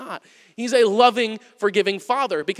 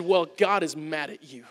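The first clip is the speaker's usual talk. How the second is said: put-on child's retort; 6 semitones lower, 7 dB quieter than usual. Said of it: "And he said".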